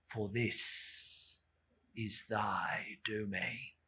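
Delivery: low, very slow, fading to a whisper; solemn incantation. A narrator's reading. Narrator invokes death over the body, low and slow. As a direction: intone it like a dirge.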